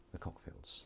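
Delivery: barely audible whisper; hushed, voiceless mention; whispered reveal. Real, not acted. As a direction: whisper the name under your breath. Withholding the sitcom's name.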